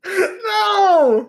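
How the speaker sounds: high laughing yell of protest; shouting. Real, not acted. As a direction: yell it out in disbelief. Player reacting to racism joke.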